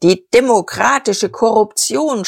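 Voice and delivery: high, heated political accusation; impassioned cry. Acted. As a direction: cry it out, beside yourself with passion. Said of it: "schrie er, tanzend vor Leidenschaft".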